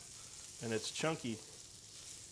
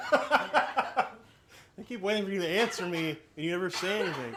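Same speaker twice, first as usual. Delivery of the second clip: laughing; 6 semitones higher, 5 dB louder, slower than usual. Real, not acted. Laughing at silent crew.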